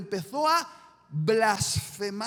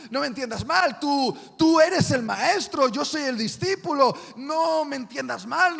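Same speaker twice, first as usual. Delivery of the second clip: loud, high, cocky disciple pleading his loyalty; boastful Peter; 4 semitones higher, 5 dB louder than usual. Acted. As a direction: brag it to the master's face, voice raised.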